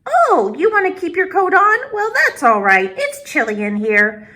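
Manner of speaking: put-on high, bright old grandmother voice; old woman greeting a visitor at her door; Baba Brina greeting. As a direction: welcome the guest like a kind old granny.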